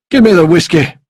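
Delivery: loud, high, forceful order; rough-mannered, shouted command; demanding desperate man. A narrator's reading. Drinking man demands bottle.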